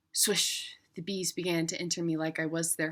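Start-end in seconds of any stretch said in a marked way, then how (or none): 0.1-0.8 breathy whispered swish. Acted. Bees swarming in, a whispered swoosh. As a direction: whisper the swish like wings rushing in. Bees enter her, sound effect.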